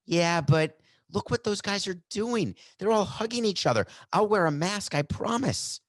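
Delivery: high-pitched mock pleading; pleading protest. Player begging to join his hugging teammates, pleading. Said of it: "That's not emphatic".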